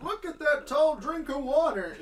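high, loud mock heckle; yelling out. Imagined audience member yelling at the character, high mock yell. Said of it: "someone could yell out".

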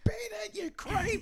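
high squeaky mock shout at paparazzi; shrill mock yell. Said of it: "you're like".

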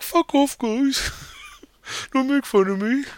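embarrassed brush-off. Retold or imitated. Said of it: "he's like".

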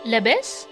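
Lebes said the Tunisian way, leh-BESS, light rising question; Tunisian dialect greeting. Shown as a demonstration. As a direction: say Lebes as a native Tunisian greeting, asking how are you. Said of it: "That's how we say".

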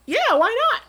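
high, loud breezy imitation of his friend; friend's casual yes. Recalling his friend casually agreeing, high breezy mock voice. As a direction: toss it off lightly in her voice. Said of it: "And she said".

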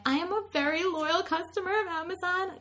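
high teary pleading, imitated; retold crying. Customer sobbing at Amazon rep, high teary plea. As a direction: whimper it like a tearful customer. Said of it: "crying, weeping".

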